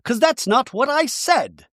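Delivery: high-pitched, exaggerated self-justifying voice; hammed-up high voice setting the record straight; defensive talking head. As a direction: protest in a high mock-defensive voice. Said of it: "Bronwyn in a talking head says".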